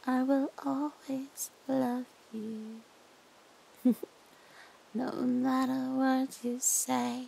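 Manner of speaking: hushed, doting captor who won't let go. Acted. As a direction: lull your captive with creepy tenderness. Holds a soft captor roleplay voice.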